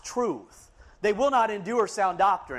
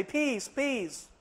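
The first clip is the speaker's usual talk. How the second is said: softer, soothing mock reassurance; the world soothing a sinner, mock soft; 4 semitones higher, 6 dB quieter than usual. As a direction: croon it like the world flattering you. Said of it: "pat you on the back and say".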